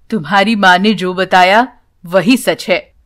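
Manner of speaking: a sad grandfather admitting the truth; old grandfather. A narrator's reading. Grandfather answers the child.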